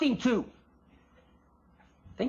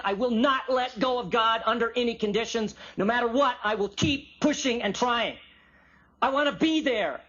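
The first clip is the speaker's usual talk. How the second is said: higher-pitched, insistent declaration; persistent; 5 semitones higher than usual. Acted. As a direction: refuse to let go, pushing each phrase. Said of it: "chatsufo means a persistent".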